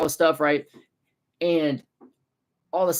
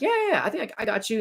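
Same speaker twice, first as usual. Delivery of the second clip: eager agreement; 9 semitones higher, faster than usual. Retold or imitated. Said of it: "I'm like".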